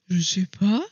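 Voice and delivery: ordinary talk, nothing marked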